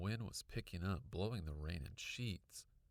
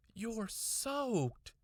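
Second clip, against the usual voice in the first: high, somewhat louder exclamation; scolding mom exclaiming about the soaking; cried out; 4 dB louder than usual. A narrator's reading. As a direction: mom crying out at the wet child. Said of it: "she cried".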